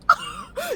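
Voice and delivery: high strained laugh; squeaky laugh-cry. Real, not acted. Vlogger on Gangle scene, squeaky laugh-cry. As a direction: laugh through near tears.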